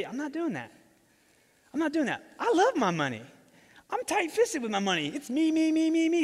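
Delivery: mock the Pharisees as greedy brats; mock sneering Pharisee. Preacher mocking the Pharisees' greed, whiny high sneer.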